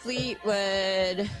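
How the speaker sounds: ordinary talk, nothing marked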